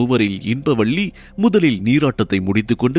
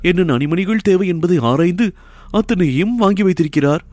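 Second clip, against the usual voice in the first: teasing mockery; 6 semitones higher than usual. A narrator's reading. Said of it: "மீண்டும் கேலியாக சிரித்த இன்பவள்ளி".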